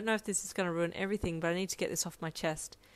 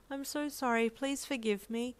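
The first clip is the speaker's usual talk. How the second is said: higher, tender plea, slowing down; pleading apology; 5 semitones higher than usual. A narrator's reading. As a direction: beg forgiveness gently. Hugging her, begging forgiveness.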